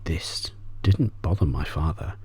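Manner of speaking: ordinary delivery, nothing marked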